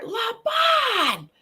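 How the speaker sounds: high called-out name; Shelley calls his name from a distance, high call; retold yell. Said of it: "Why is she yelling at me?".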